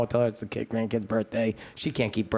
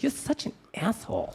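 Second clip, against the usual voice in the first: high, thin imitation of mom; mother's retort; 11 semitones higher, 4 dB quieter than usual. Mother answering son's nose joke, affronted high voice.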